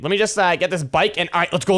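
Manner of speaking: ordinary talk, nothing marked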